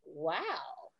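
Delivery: low, hushed "wow"; stunned wow. Retold or imitated. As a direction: say it softly to yourself, stunned. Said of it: "And I was like".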